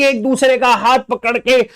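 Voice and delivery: ordinary talk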